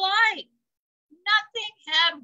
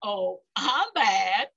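slow, smug brag; exclaimed; 5 semitones lower, slower than usual. Acted. Goat gloating after knocking over skunks, smug. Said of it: "he exclaimed".

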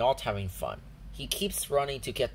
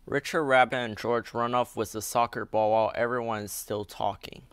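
usual speaking voice

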